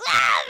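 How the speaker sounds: short, loud re-enacted scream; sudden startled yell, full volume. Showing how they yelled at the jump scare, loud yell. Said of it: "I yelled really loud in the movie theater".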